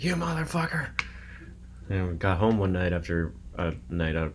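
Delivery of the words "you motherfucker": imitating the dad, high pitched; mock the dad's outburst in a raised voice; angry dad voice. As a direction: caricature of a roommate's angry father. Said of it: "He's like".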